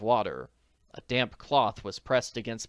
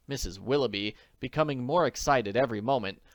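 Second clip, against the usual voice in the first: usual speaking voice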